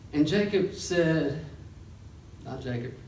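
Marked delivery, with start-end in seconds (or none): none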